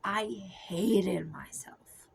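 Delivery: "hated" dragged out slow in a whisper; stress on hated. Real, not acted. Emphasizing "hated" to viewers, slow whispered stress. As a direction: lean hard and slow on "hated". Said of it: "I can't emphasize that word enough".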